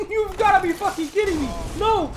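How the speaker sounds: high, loud outraged yell; furious shout. Real, not acted. Streamer yelling in disbelief at his killer. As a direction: explode at the game. Raging at game enemy.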